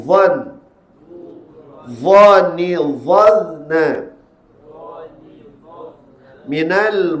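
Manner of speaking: slow, drawn-out chant with heavy dha; recitation drill. Shown as a demonstration. Teacher leads the class practice on dha, measured chanted recitation. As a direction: intone each item fully and leave room to repeat. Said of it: "kita latih pada huruf dha".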